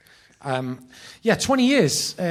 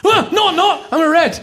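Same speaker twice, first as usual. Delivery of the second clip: much higher, louder laughing scramble; panicked backtrack; 13 dB louder than usual. Retold or imitated. Fan retracting to his hero, high laughing panic. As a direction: flip your answer in a flustered rush. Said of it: "And I went".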